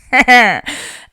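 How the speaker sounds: a loud falling laugh through tears; tearful laugh. Real, not acted. Talking about dad, breaks into a tearful laugh. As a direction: laugh at yourself crying.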